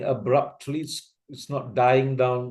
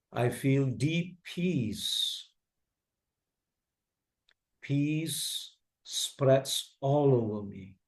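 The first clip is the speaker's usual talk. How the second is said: slow, soft guided meditation read; calm sleep commentary, unhurried and hushed; meditation voice-over; 2 semitones lower, 4 dB quieter, slower than usual. Shown as a demonstration. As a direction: breathe it out slowly, long pauses between phrases. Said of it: "meditation commentaries".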